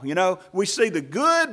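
normal talking voice

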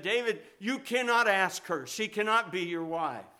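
a firm, loud motherly refusal; stern mother forbidding. Retold or imitated. Mother forbids son's marriage.

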